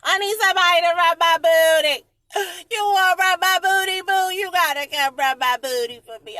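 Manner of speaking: high, loud laughing wail; joking cry for a booty rub; laughing plea. Real, not acted. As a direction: beg through the laughter.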